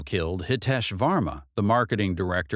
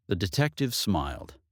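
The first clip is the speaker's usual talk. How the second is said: ordinary delivery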